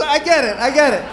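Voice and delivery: ordinary talk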